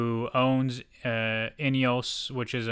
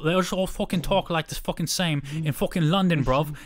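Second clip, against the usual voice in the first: loud, high London British accent; 5 semitones higher, 3 dB louder than usual. Shown as a demonstration. Friend mocking how Londoners talk, in a British accent. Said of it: "unless I do my British accent".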